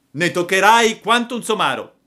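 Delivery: high, loud bully's warning; angry threat. Acted. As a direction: threaten a thrashing. Boy threatens a beating. (minaccia infuriata)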